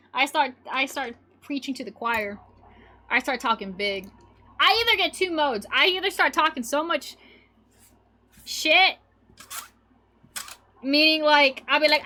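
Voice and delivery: ordinary talk, nothing marked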